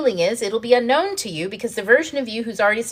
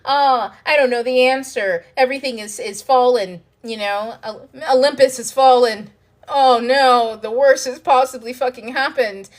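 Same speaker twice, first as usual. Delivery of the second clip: higher, louder mock panic, hamming up the end of the world; mock dramatic despair; 5 semitones higher, 6 dB louder than usual. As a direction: play the drama queen whose world has collapsed. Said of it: "You're like".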